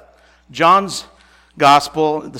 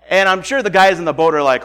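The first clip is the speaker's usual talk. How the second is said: ordinary talk, nothing marked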